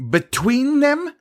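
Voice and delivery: very high, loud shocked cry; can't believe the order; shrill disbelief. A narrator's reading. Sisay questions Gerard through tube, shrill. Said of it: "Her voice was shrill in the tube".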